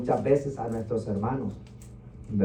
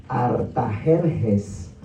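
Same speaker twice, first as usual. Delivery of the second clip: Artajerjes stretched syllable by syllable, much slower; slow spelled-out name; 6 semitones lower, 3 dB quieter, much slower than usual. Shown as a demonstration. Preacher sounds out Artajerjes for the congregation, very slow. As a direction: draw out Artajerjes very slowly for the room. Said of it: "diga conmigo".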